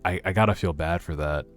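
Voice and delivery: usual speaking voice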